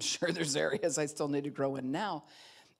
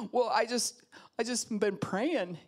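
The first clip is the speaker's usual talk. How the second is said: higher, louder, slow hesitant excuse; hesitant congregant; 6 semitones higher, 4 dB louder, slower than usual. Retold or imitated. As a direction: stammer out a lame excuse. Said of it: "what'd you say".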